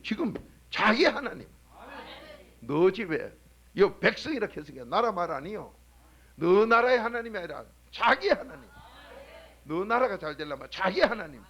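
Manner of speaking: loud, driving sermon voice; preaching at full force. Acted. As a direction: preach it hard to the pews. Preacher exhorting the congregation.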